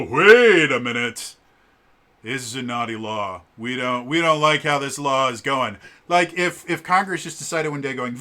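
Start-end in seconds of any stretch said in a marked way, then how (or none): 0.0-5.8 put-on fun non-American accent. Judicial branch objecting to a law, in a fun non-American accent. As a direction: play the judges in a hammed-up non-American accent. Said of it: "they're fun accents".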